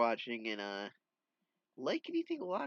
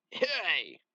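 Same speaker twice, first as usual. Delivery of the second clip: high, loud shouted call; acted shout; 6 semitones higher, 6 dB louder than usual. Comic-book character calls out to stop someone, shouting. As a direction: call out sharply, pitch falling away.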